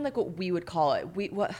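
normal talking voice

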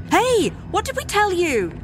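put-on high, sharp telling-off of kids; furious scolding. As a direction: scold the runaway children. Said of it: "join me in furious chorus".

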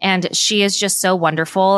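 ordinary talk, nothing marked

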